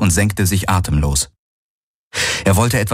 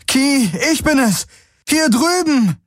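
joyful shout. Acted. Galen calls Ki across the fields, high and joyful. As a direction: call her across the fields.

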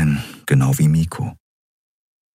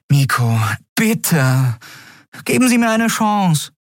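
pleading; 12 semitones higher than usual. A narrator's reading.

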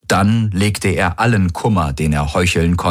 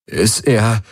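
raised, faltering question; voice breaking; 4 semitones higher than usual. A narrator's reading. Man asking about his friend, voice breaking. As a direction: let the voice catch, putting on grief. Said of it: "ließ seine Stimme brechen".

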